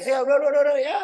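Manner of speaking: very high, loud alarmed yelling; frantic shouting. Retold or imitated. Household yelling at the praying imam, loud and high. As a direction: shout urgently to stop. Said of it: "Mereka teriak-teriak".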